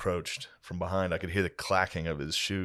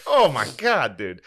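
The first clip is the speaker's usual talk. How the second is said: overwhelmed; 4 semitones higher, 6 dB louder, slower than usual. Retold or imitated. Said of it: "I was just like".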